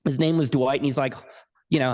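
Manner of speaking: usual speaking voice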